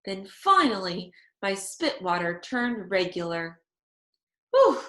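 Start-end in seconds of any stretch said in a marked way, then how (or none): 4.5-4.8 relieved whew. A narrator's reading. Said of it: "I said".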